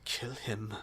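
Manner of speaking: very quiet, menacing order; spitting it out. Acted. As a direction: order it under your breath. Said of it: "tries to spit something out".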